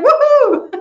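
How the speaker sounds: high laughing woohoo; gleeful whoop. Real, not acted. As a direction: whoop with delight, then laugh. Celebrating sunny day.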